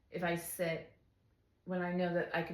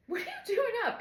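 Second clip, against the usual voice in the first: high-pitched baffled question with a laugh; squeaky amused disbelief; mock surprise; 4 dB louder than usual. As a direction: imitate a startled husband, half laughing. Imitates surprised husband, laughing.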